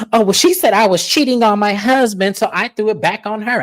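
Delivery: high-pitched, indignant excuse; defensive retelling. Retold or imitated. Co-host retells Lisa excusing her accusation, defensive.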